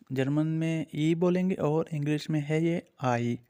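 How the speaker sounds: ordinary talk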